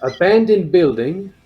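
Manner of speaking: halting, lightly mocking ghost-hunter narration; mocked paranormal investigator. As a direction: imitate an amateur ghost-hunting video, pitch lifting in places. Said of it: "it's like this".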